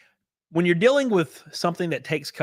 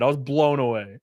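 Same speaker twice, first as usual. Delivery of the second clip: ordinary delivery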